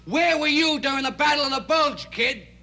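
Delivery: high, loud retold shout; mock-furious parental challenge; imitating father's yelling. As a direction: yell it like an angry dad scolding his kid. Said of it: "he yelled at me".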